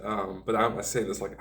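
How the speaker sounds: usual speaking voice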